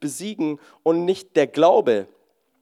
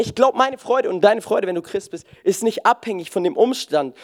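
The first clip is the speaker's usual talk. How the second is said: normal talking voice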